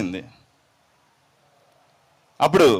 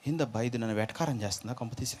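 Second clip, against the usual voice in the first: soft, lower, worried inner voice; worried mutter; 6 semitones lower, 10 dB quieter than usual. Acted. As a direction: mutter it under your breath, unsure. Disciple's worried inner thought.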